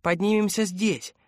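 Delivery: put-on high, insistent child's call; urgent girl calling. A narrator's reading. Girl at the foot of the shaft to adults, urgent calling. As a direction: beckon them urgently in a little girl's high voice. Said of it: "неистово жестикулировала".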